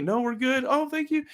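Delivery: light high-voiced thank you; high polite reply.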